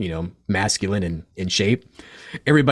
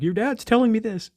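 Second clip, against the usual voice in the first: mocking channeler; 13 semitones higher, 3 dB louder than usual.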